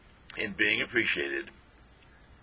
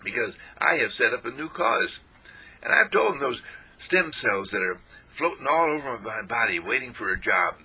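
ordinary talk, nothing marked

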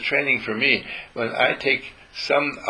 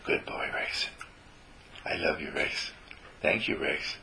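breathy whispered love for the dog; whispered praise; 7 semitones lower, 10 dB quieter than usual. Shown as a demonstration. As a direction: whisper it softly into the dog's ear. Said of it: "whisper in his ear".

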